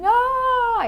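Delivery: high, loud "ah!" of being caught; caught-out gasp. Retold or imitated. Patient caught with snacks in her bag, high loud yelp. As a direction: yelp as the secret spills out.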